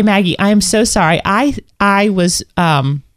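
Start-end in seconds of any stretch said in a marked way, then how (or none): none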